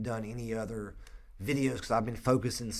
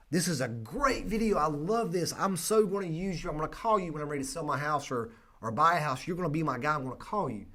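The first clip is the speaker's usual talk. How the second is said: much higher, light mock excitement; gushing commenters; 6 semitones higher, 3 dB louder than usual. Fans commenting on the viral video, in mock gushing praise. Voicing enthusiastic Facebook comments.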